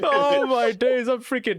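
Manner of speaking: breathless laughing oh my days; words through real laughter. Real, not acted. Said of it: "I can't stop laughing".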